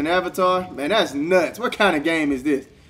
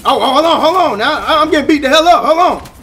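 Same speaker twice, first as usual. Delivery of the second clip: loud, high, laughing panic; panicked gamer hollering mid-brawl; frantic excited yelling; 7 semitones higher, 5 dB louder than usual. Real, not acted. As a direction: shout through a losing fight. Getting beaten, frantic in fight.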